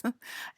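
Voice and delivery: breathy burst of laughter; stifled laugh. Real, not acted. ASMR host cracking up at a joke, stifled laugh. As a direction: laugh breaking the whisper.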